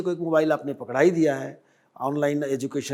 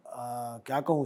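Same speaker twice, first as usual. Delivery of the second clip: usual speaking voice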